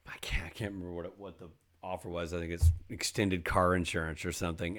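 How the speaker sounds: ordinary talk, nothing marked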